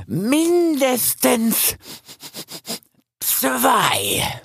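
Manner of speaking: high, slow, drawn-out accusation; haughty stag voice, accusing; aggressive young stag, suspicious. A narrator's reading. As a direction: draw out each sniffed-out clue, pitched high. The suspicious stag sniffs out boar.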